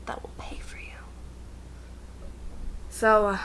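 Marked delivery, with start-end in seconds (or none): 0.1-0.9 whispered aside. Real, not acted. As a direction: drop to a secret whisper.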